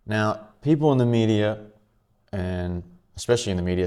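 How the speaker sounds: usual speaking voice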